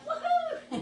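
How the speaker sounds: very high, loud squeaky whoop; squeaky woohoo for the little gnome; gnome cheering. Pretend. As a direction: cheer like a tiny thrilled gnome. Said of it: "So he's like".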